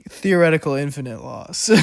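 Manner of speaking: laughing through the words. Real, not acted. Tutor amused by her own outburst, laughing. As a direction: chuckle as you say it.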